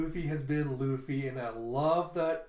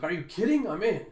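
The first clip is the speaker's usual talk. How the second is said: higher, louder, thrilled yes; excited; 4 semitones higher, 7 dB louder than usual. Acted. A pirate eagerly grabbing Kaido's offer, excited shout.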